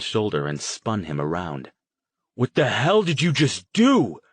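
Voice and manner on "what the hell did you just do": high, loud furious scream in his face. Acted. Jason confronting Harrison after the killings, furious scream. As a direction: scream it in outrage. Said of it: "he screamed".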